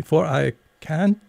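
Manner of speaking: put-on high, polite little girl; polite and a little puzzled; polite Alice. A narrator's reading. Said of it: "Alice replied very politely".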